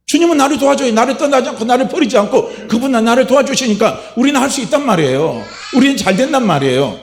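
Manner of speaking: ringing pulpit cry; fervent shout of encouragement. Acted. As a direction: urge yourself on at full preaching force. Preaching at full force.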